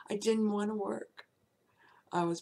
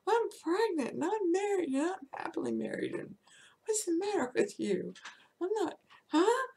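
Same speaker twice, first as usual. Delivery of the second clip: indignant outburst; 11 semitones higher than usual. Retold or imitated. Pregnant secretary protesting to her groping boss, high and outraged. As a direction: tell him off in outraged disbelief.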